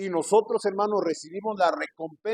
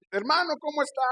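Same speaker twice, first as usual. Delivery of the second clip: high, friendly greeting voice; brotherly greeting; 11 semitones higher than usual. Retold or imitated. Pastor greets a brother he meets, high and friendly. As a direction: call out hello to a brother, pitched up. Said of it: "Me encuentro un hermano".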